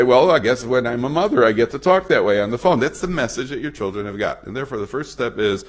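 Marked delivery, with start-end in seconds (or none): 0.0-2.8 put-on sarcastic child. Child mimics mother sarcastically.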